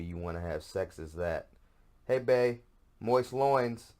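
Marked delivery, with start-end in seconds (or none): 2.1-3.9 louder, higher mock-sultry come-on; overdone goofy booty call; hammed-up seductive partner. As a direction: absurd lover inviting him over. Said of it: "Sesame Street way to tell me".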